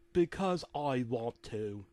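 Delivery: slow, drawn-out put-on voice; slow character voice for the father; gruff-spoken dad character. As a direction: drag it out in the dad's character voice. Phil's line in read-aloud story.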